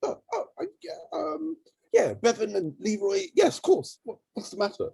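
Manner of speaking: flustered, high-pitched stammering reply; Boris. Retold or imitated. Imitating a politician asked about a charity, flustered. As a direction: imitate a nervous man groping for an answer. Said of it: "quite scared, a bit nervous".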